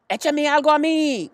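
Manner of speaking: put-on very high, loud boyish shout; boy begging to be soaked too; Avery yells. A narrator's reading. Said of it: "gritó Avery".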